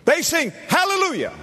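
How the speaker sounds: high, ringing preacher cry; soaring exultation. Real, not acted. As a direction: lift it up in praise. Preacher exults over hallelujah.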